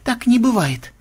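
high, restrained denial; composed but strained refusal; controlled disbelief. Acted. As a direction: deny it firmly without raising volume. Melissa's denial before she explodes.

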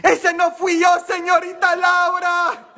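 high, loud, laughing denial; mock TV talk-show plea. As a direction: plead innocence like on Laura en América. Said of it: "¿Qué dije yo?".

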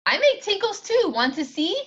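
put-on high, loud little girl offering. A narrator's reading. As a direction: chirp it high like a bold toddler. Little girl voiced in story.

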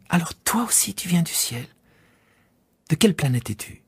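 put-on high, breathy, curious child's question; eager whisper. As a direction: lean in with wonder.

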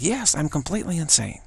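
happy. Shown as a demonstration. Teacher agreeing with the ego in a happy voice. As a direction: agree with it gladly, as if it were good news. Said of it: "But I said it, like, happy".

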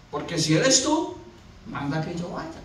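low, softened asking; hushed, daring proposal; tentative request. Acted. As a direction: drop to a careful, quiet plea. Pedro's cautious request.

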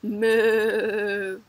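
low mooing cow. Shown as a demonstration. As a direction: moo like a laughing cow. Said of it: "How would the cow sound if he was laughing".

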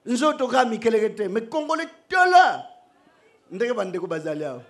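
normal talking voice

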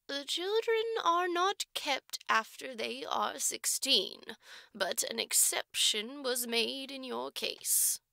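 slow, placid and deliberate matron; slow placid manner. Acted. Matron keeps Jerusha waiting, slow and placid. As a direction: drag it out calmly to try her nerves. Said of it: "resumed in a slow, placid manner".

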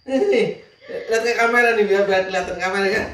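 high, loud, words squeezed out through laughter; laughing hard. Real, not acted. Husband laughing at his wife to the camera, high laughter. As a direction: laugh helplessly while pointing at her.

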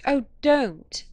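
loud, high cry of protest; exclaiming. A narrator's reading. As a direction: burst out pleading. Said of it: "I exclaimed".

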